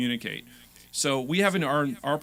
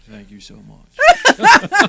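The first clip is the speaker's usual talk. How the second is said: slow, high, much louder sexy voice; hammy seductive thank you; 8 semitones higher, 19 dB louder, much slower than usual. Pretend. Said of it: "that wasn't a good sexy voice".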